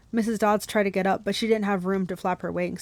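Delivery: usual speaking voice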